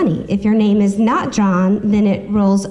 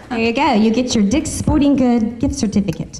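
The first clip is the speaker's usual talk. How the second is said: usual speaking voice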